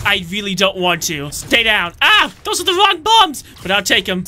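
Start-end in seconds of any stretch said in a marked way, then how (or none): none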